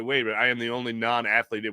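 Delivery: ordinary talk, nothing marked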